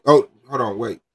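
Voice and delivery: ordinary talk, nothing marked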